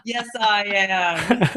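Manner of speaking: slow words through a laugh; laughing echo. Acted. Echoing the patient line while laughing. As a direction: drag it out, laughing.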